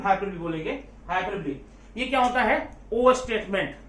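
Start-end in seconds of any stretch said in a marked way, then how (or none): none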